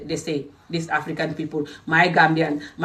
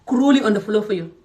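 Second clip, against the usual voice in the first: usual speaking voice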